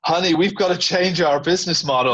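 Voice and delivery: high, thrilled call across water; excited shout. Retold or imitated. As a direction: call out to your wife, amazed. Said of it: "he shouts across the water to his wife".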